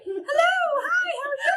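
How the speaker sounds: high, strained cracking greeting; squeaky high voice. Shown as a demonstration. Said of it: "options that I have for my voice".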